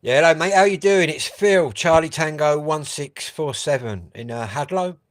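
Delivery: loud, high radio call. Real, not acted. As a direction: shout your call sign across the distance. Calling into the radio.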